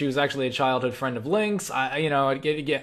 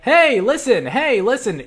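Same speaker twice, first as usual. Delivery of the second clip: high, loud, insistent sing-out; Navi; 7 semitones higher, 7 dB louder, slower than usual. Retold or imitated. Gamer mimicking an annoying game companion, high and loud. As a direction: mimic a pestering call for attention. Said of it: "she always said".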